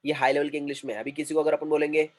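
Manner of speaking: ordinary talk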